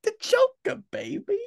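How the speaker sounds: high squealing Joker impression. Mimicking Joker catchphrase.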